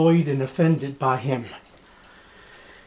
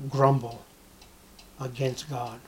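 soft grumble fading to almost nothing; grumbling; 5 semitones lower, 10 dB quieter than usual. Shown as a demonstration. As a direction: mutter it off into the corner, dying away. Preacher shows grumbling in corner.

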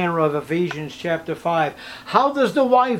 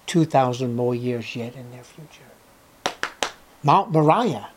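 ordinary delivery

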